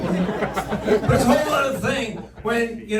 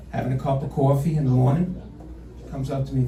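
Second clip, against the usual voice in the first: ordinary talk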